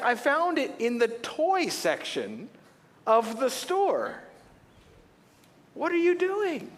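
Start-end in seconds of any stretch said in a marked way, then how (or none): 5.7-6.7 high, stretched incredulous cry; boy silently protesting his gift shut in a closet; exasperated disbelief. Retold or imitated. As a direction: plead in comic disbelief. Exasperated inner outcry.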